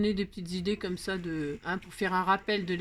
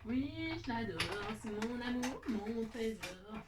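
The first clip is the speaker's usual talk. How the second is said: tendresse; 7 dB quieter, slower than usual. Real, not acted. Parle tendrement de sa carte.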